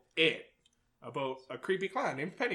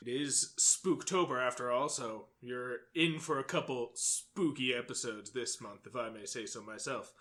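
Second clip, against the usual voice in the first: sultry spooky voice; 5 semitones lower, 4 dB quieter than usual. Acted. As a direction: purr the intro low and spooky. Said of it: "Or sultry voice".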